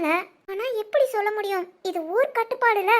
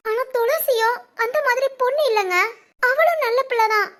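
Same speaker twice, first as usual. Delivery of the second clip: ordinary delivery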